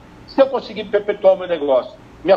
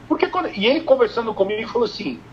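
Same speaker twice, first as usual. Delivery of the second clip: normal talking voice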